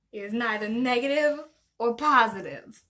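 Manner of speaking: lightly put-on Long Island mom accent. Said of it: "That Long Island mom voice".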